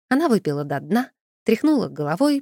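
ordinary delivery, nothing marked

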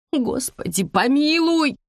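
high, drawn-out groan of despair; woman's exasperated rising wail; moaning. A narrator's reading. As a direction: moan a plea to heaven. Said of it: "простонала женщина".

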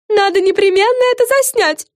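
high, loud, eager fairy voice; high-pitched, excited exclamation; excited cry. A narrator's reading. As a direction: cry out with urgent enthusiasm. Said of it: "вскричала Френ".

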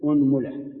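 أنملة with u on the hamza, unmula; أنملة: u-nmu-la, damma on the hamza. Shown as a demonstration. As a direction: say أنملة starting with u. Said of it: "ضم الهمزة".

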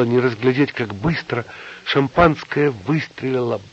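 ordinary delivery

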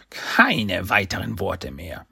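langsam gedehnte, verstellte Stimme; fluchendes Gehirn. A narrator's reading. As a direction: jedes Wort langsam und herrisch verbieten. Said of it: "das größte und einzige körperlose Gehirn der Galaxis fluchen". (langsam fluchendes körperloses Gehirn)

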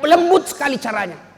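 ordinary talk, nothing marked

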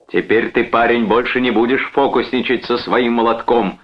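mild shout of triumph; shouting after him. A narrator's reading. Brother taunts the man he struck, shouting. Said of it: "крикнул Чарльз ему вдогонку".

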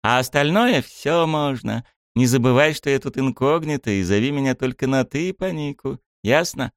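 high bossy princess voice; lightly voiced princess giving orders; cartoon princess. A narrator's reading. (высокий голос капризной принцессы)

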